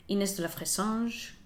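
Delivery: ordinary delivery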